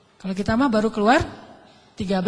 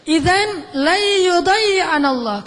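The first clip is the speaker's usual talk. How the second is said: slow emphatic recitation; 6 semitones higher, 5 dB louder, much slower than usual. Acted. Hajar's Arabic reply, emphatic.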